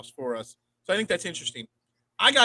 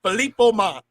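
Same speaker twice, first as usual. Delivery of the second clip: a lightly put-on French accent, a bit higher and louder; 4 semitones higher, 6 dB louder than usual. Podcast host hams up a player's name in French accent. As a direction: say the name like a Frenchman, half joking. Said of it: "my best French, French accent I could do".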